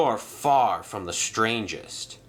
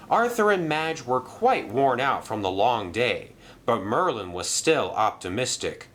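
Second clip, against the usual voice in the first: plain reading voice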